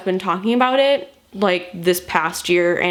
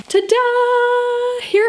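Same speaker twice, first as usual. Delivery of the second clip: ta-da reveal; 14 semitones higher, much slower than usual. Real, not acted. Reveals the book haul.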